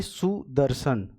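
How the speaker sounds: सुदर्शन slowly with a short उ in सु; slow spelling. Shown as a demonstration. Teacher slowly showing the short उ in सुदर्शन. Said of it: "सु छोटे उ की मात्रा".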